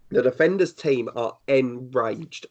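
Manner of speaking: "enraged" drawn out with heavy emphasis; slow right down and lean on "enraged"; stress on ENRAGED. Real, not acted. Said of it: "I cannot stress that word enough".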